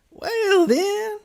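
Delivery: very high, loud cat voice; triumphant Cheshire Cat. A narrator's reading. Cat pressing its argument, very high and loud. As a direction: swoop right up on both words.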